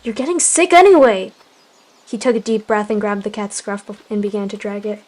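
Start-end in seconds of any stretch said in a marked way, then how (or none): 0.0-1.3 loud high argument; angry shouting. Acted. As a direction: shout each other down.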